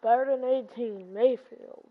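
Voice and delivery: lightly voiced sports announcer; play-by-play. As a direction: call the play slowly like a commentator.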